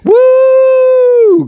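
high, loud whoop of praise; sermon outburst, real shout of glory; joyful whoop. Real, not acted. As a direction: let the spirit burst out. Preacher shouts praise mid-sermon.

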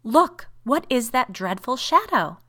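loud, high, slow cry of horror; horrified demand. A narrator's reading. Woman points her sister to the shadow, horrified. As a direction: draw it out high, aghast.

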